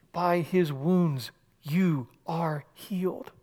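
slow, hushed, reverent; slow reverent reading. Acted. Preacher reading the healing verse slowly and reverently. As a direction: let each word land slowly. Solemn scripture quote.

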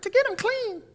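high, lightly voiced child answer; a hint of an eager child; kids' answer. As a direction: answer like a kid in class.

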